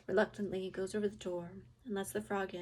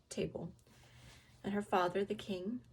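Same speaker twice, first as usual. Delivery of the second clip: ordinary delivery, nothing marked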